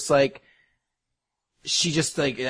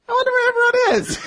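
very high, louder cute voice; lonely girl; 5 dB louder than usual. Retold or imitated. Narrator voicing the forgotten girl in the library, very high and cute. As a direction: chirp it like a lonely anime girl waiting alone. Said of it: "And she's like".

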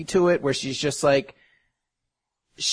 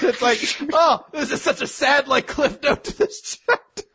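higher, laughing through the words; laughing while talking; 12 semitones higher than usual. Real, not acted. Host laughing at the sad chapter ending, higher. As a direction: crack up mid-sentence.

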